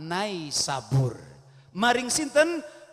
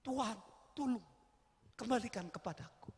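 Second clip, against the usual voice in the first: soft plea; 10 dB quieter, faster than usual. Acted. Ghazali praying softly.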